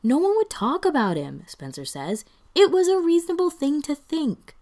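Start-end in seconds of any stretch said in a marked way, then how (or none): 0.0-1.4 high, loud self-justification; defensive protest. A narrator's reading. Spencer excuses his mistake to Brendan, defensively. 2.5-4.6 very high, loud, indignant insistence; defensive protest. A narrator's reading. Spencer justifies his wrong guess to Brendan, indignantly defensive.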